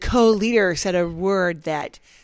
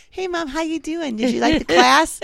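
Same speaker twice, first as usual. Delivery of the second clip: sweet cheery greeting; 7 semitones higher than usual. Retold or imitated. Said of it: "I said".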